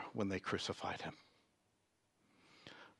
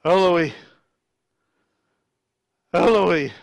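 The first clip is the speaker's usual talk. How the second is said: crying out, loud and higher; 4 semitones higher, 8 dB louder than usual. A narrator's reading. Jesus cries out from the cross, loud anguished call. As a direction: cry it out, each word on its own. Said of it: "cried out in a loud voice".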